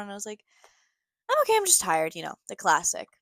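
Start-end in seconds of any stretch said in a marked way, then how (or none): 1.3-2.1 retold high, tight "I'm fine"; mock-cheerful cover for tears; holding back tears. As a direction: pitch it way up, imitating a brave front before crying. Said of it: "literally on the brink of crying".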